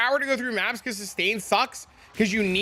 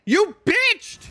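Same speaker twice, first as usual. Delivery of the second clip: mock high accusation; 11 semitones higher, 5 dB louder than usual. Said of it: "G G's like".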